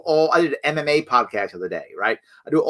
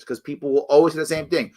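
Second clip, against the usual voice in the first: ordinary talk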